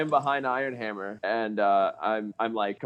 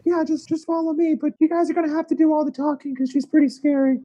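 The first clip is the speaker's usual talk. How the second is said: high character voice. Acted. Player voices his character.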